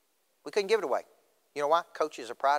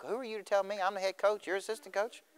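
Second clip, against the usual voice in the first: higher-pitched mock indignation; indignant retort; 8 semitones higher, 4 dB quieter than usual. Head coach retorting to the assistant's advice, indignant.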